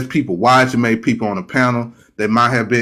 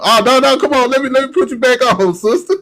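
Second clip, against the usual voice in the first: much higher, pleading "come on"; high-pitched dismay; 11 semitones higher than usual. Real, not acted. Host losing a caller, high-pitched dismay. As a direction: yelp it, high and quick.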